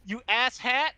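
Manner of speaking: high, loud scornful jab; exasperated insult. Real, not acted. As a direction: throw the insult at them. Insulting the paramedics.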